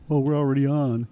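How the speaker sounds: imitating cohost. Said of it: "all of a sudden".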